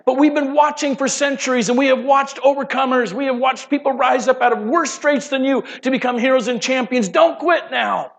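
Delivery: high, loud cheering shout; rally them like a stadium crowd. Acted. Angels cheering a struggling believer on, loud excited shouts.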